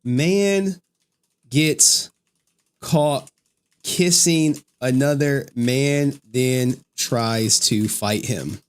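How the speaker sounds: slow, drawn-out headline read; slow dramatic caption reading. Shown as a demonstration. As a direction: read the clip title slowly, for effect. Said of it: "it reminds me of this clip".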